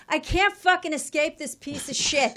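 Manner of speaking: loud, dragged-out exasperation; exasperated outburst. Retold or imitated. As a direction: let it out like you're trapped. Retelling seeing Air Force One.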